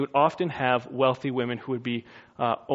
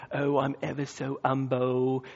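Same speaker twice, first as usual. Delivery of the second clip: mock English accent, softer, oily and humble; bad English accent; 5 dB quieter than usual. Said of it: "really bad English accent for humble".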